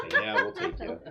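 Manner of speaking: ordinary delivery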